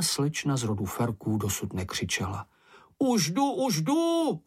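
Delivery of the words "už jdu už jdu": high, sustained rescue call. A narrator's reading. Mumínek calls to Ferka that help is coming, high call. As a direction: pitch it far up, calling ahead. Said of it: "volal Mumínek".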